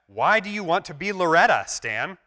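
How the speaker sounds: lightly voiced sketch friend; puzzled friend. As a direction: ask in mild disbelief. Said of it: "friend asks".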